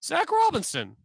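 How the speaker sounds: high, puzzled, then catching on; surprised realization. Pretend. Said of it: "everybody around the country is gonna look around and say".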